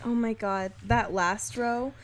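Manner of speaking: usual speaking voice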